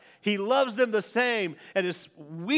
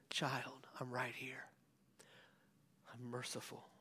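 softly whispered, lower voice of God; tender whisper; 5 semitones lower, 19 dB quieter than usual. Acted. Pastor voicing God whispering to a lost child.

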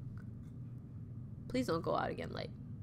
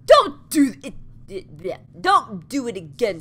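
louder stern don't do it; stern scolding; 9 dB louder than usual. Acted. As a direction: scold the child sharply. Caretaker scolds girl in game.

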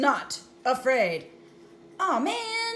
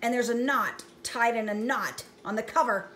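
ordinary delivery

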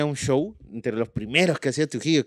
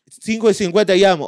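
ordinary talk, nothing marked

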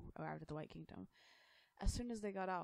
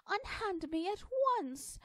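high, loud, slow indignant scream; the girl shrieking as guards lift her; 10 dB louder, slower than usual. Acted. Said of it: "Alice screamed".

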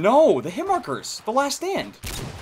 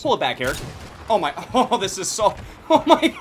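ordinary talk, nothing marked

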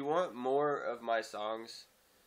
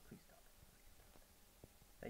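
breathy whispered please; much slower than usual. Real, not acted. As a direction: whisper it as a joking plea.

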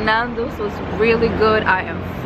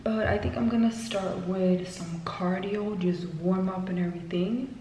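hushed near-whisper, much softer; 11 dB quieter than usual. Real, not acted. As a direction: keep it hushed so nobody in the building wakes. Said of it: "I feel like I'm whispering".

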